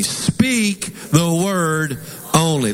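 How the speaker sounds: very slow, each word set apart; slow emphasis. Shown as a demonstration. Preacher has congregation say it with him, slow emphasis. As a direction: lead the room word by word, slowly. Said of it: "I really want to emphasize this".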